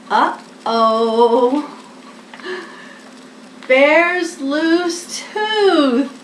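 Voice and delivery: drawn-out, slow dramatic dismay; mock alarm at the wobbly tooth; uh-oh surprise. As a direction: land the surprise ending slowly. Dramatic ending of story.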